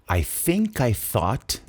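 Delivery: slow "I think I thought", both TH sounds between the teeth; tongue-between-teeth TH. Shown as a demonstration. Teacher drills TH for pronunciation practice, slow careful dental TH. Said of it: "duas vezes essa língua entre os dentes".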